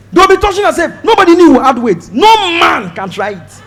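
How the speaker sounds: usual speaking voice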